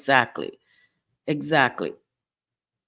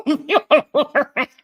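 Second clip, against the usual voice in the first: laughing; 9 semitones higher, 5 dB louder than usual. Real, not acted. Repeats it, laughing higher.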